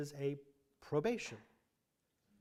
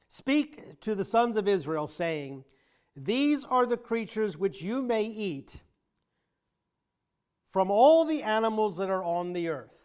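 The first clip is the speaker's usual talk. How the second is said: These and all punched louder and higher; emphasis on key words; 4 semitones higher, 5 dB louder than usual. Shown as a demonstration. Teacher reading the verse to the class, stressing These and all. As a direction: lean hard on These and on all. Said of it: "I'm gonna put some emphasis on the words".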